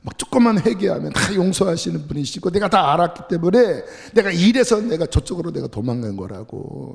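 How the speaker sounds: ranting at God. Retold or imitated. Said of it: "막 나를 죽여달라고".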